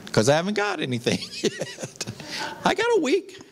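quick admission breaking into a laugh; amused, sheepish admission; laughing confession. Real, not acted. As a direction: confess it with a chuckle. Joking confession about wife's birthday gift.

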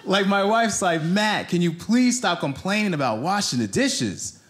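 usual speaking voice

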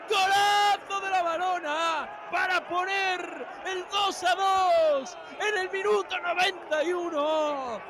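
high shouted goal celebration; breakneck goal commentary. Real, not acted. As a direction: pour out the praise, shouting.

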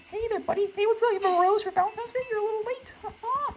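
very high, sweet mock-friendly voice; high cartoon voice luring the victim; falsetto greeter.

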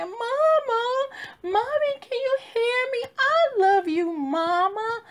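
put-on a grieving daughter calling for her mama like a little girl; calling for mommy. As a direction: cry out like a lost child. Calling for her late mother.

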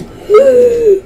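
sharp gasp. Real, not acted. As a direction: gasp in shock. Sudden gasp at a play.

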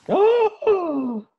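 high, loud "Oh!"; big thrilled cry of surprise; delighted surprise. Real, not acted. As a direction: exclaim it high in amazement. Reacts to being named godfather.

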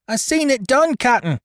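put-on high, loud, shrill cabin boy's accusation; shrill it out like a spiteful young imp. A narrator's reading. Spiteful cabin boy accusing George to the captain, shrill and high. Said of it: "shrilled that little devil Dickon".

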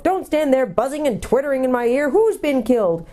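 high, scolding dwarf voice; impatient deaf dwarf. A narrator's reading.

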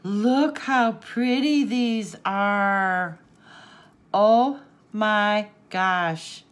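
slow, loud, drawn-out admiration; emphatic, lingering delight; awed gushing. Real, not acted. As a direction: stretch each word in wonder. Savouring pretty gifts.

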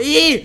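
loud, high-pitched "eee"; piercing gamer squeal. Real, not acted. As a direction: squeal out suddenly. Squeal during the fight.

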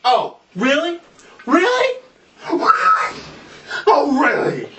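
rising squeal of disbelief; mock outrage.

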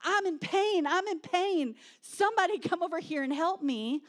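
loud mock cry of pain; acted crying out. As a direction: call out urgently as someone hurting. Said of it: "they're crying out".

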